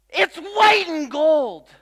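high, loud, slow, dead certain; insistent claim. Retold or imitated. Secretary insisting on the dress color, loud and slow. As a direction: plant your flag on every word. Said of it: "And she says".

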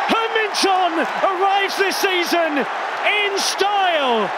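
rising, thrilled call on the goal; excited commentary call. Real, not acted. Commentator hailing Son's goal with excitement. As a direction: lift the voice high as the shot curls in.

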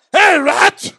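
shouted "Hey, rat!" with a rising sneer; taunting yell. Retold or imitated. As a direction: bark it like a mocking upperclassman. Recruits taunt him at military school.

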